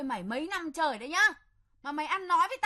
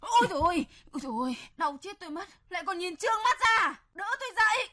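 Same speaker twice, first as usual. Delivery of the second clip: hammed-up pain; 5 semitones higher, faster than usual. Stepmother pretending to collapse in front of the son, hammed-up wailing. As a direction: ham up a faint to draw attention.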